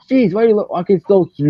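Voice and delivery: high mock complaint; exasperated cartoon kid. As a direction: whine it high, imitating an impatient cartoon character. Chowder complaining, retold.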